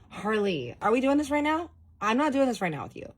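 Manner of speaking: high, loud, fed-up refusal; exasperated refusal. Retold or imitated. Refusing boyfriend who keeps asking for the story, loud exasperated. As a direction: shut him down, incredulous.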